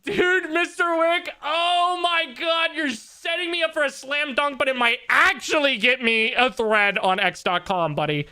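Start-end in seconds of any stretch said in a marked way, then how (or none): 0.0-7.0 much higher, slow, stretched out; real excited squeal at the chatter; excited disbelief. Real, not acted. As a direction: marvel at the perfect setup.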